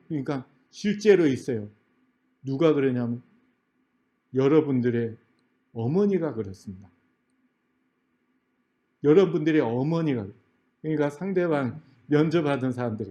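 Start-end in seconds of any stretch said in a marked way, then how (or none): none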